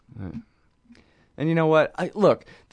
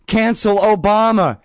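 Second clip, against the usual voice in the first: mock whiny outrage; 7 semitones higher, 4 dB louder than usual. Mocking offended liberals.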